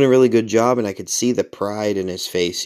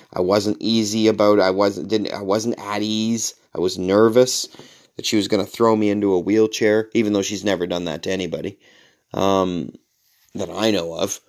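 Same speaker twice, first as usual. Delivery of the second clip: usual speaking voice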